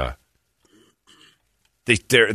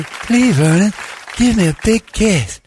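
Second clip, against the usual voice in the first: mock eager kiss plea; 12 semitones higher, 4 dB louder than usual. Voicing girlfriend asking kiss.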